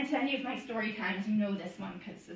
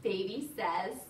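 animated, sing-song picture-book reading; playful storytime narration with stretched key words; reading to children; 8 semitones higher, 5 dB louder, slower than usual. Acted. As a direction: read it to toddlers with lively, swooping pitch.

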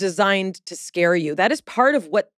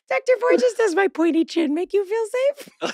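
high, mock-coy asking; silly question; 12 semitones higher than usual. Mocking herself asking the doctor silly questions, high and coy. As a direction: ask it like a cheeky schoolgirl. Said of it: "so many silly questions".

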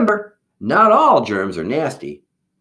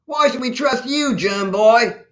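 high-pitched distrustful character voice; scowling; 12 semitones higher than usual. A narrator's reading. Soldier questions the germ's loyalty, scowling. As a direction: challenge him in a high, suspicious voice. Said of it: "scowled another".

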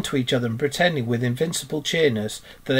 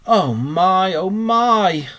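high, loud wail of woe; moaning in pain; 6 semitones higher, 6 dB louder, much slower than usual. A narrator's reading. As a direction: moan loudly over a hurt. Hurt Mole moans in story.

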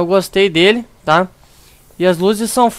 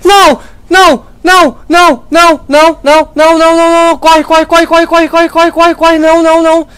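high, loud repeated screams; terrified screaming; 8 semitones higher, 7 dB louder than usual. Real, not acted. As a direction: scream in real panic, over and over. Monster attacks, screaming repeatedly.

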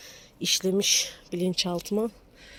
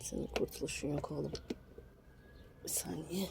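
muttering under breath; 4 semitones lower, 14 dB quieter than usual. Real, not acted. Muttering while straining.

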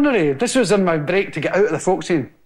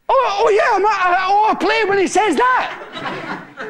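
flustered; 12 semitones higher than usual. Retold or imitated. Flustered reply to the director.

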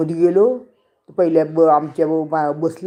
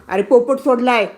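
calling out; 8 semitones higher, 5 dB louder than usual. Real, not acted. Calls out again about parrot.

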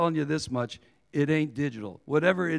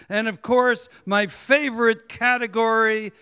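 raised, higher declaiming voice; shouted roll call; 5 semitones higher, 5 dB louder, slower than usual. Real, not acted. Poet greeting slammers, rappers and word slingers, shouted out. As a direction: call out each title slowly to the crowd. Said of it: "titles that I just sh-, shouted out here".

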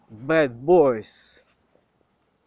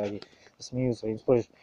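ordinary delivery

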